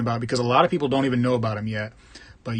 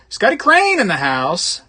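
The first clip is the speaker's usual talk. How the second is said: a loud, high, slow welcome shout; hype greeting; 8 semitones higher, 7 dB louder, slower than usual. Real, not acted. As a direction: announce him like a hype man. Greeting viewer, calling out.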